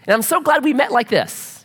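high-pitched, gushy mock newlywed; gushing homemade vows. Bride or groom reciting homemade vows, mock gushing in a high voice. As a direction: imitate a sappy couple reading their vows. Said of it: "the vows are like this".

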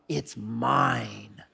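low, soft drawn-out "mine"; imitated toddler digging in; stubborn kid. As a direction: mimic a possessive little kid, quietly. Kid repeats his claim.

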